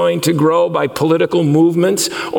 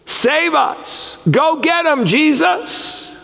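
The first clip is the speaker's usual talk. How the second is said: zealot crowd; 6 semitones higher, slower than usual. Retold or imitated. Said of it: "He's hearing them say".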